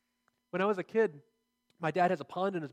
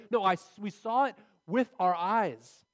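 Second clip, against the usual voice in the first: slow, louder, leaning on eyes; stress on eyes; 4 dB louder, slower than usual. Shown as a demonstration. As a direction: hit eyes hard and slow. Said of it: "There's an emphasis there on the use of eyes".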